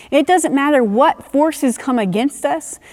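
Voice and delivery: ordinary delivery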